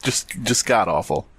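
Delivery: ordinary talk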